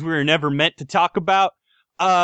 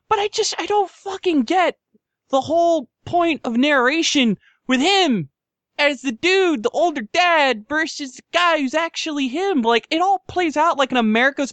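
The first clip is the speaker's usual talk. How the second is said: exasperated rant; 8 semitones higher than usual. Real, not acted.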